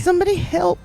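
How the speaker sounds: high-pitched play-acted plea; acted cry for help. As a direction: call out for help, hammed-up. Acting hurt, calling for help.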